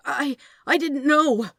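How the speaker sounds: high, loud frightened stammer. Acted. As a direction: stumble over the first word in fear. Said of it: "the angel stammered".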